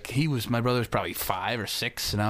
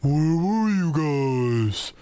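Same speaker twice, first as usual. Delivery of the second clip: slow, loud stepdad; 3 semitones higher, 4 dB louder, much slower than usual. Retold or imitated. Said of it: "he'd be like".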